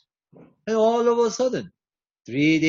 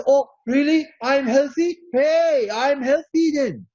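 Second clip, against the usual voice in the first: delighted surprise; 7 semitones higher than usual. Retold or imitated. Healed listener reacting to good news, high and delighted. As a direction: sound thrilled to hear it.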